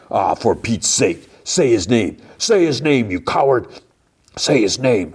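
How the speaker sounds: heated, insistent demand acted out in retelling; angry demand. Man goading a rival to name the dead friend, mock-angry. Acted quarrel, Frank taunting Matt.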